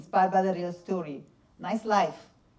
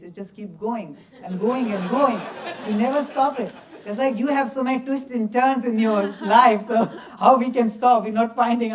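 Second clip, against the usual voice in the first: talking through laughter, louder; amused, laughing as he tells it; 3 semitones higher, 8 dB louder than usual. Real, not acted. Dancer laughing to audience about the long shoot. Laughing while recounting endless filming.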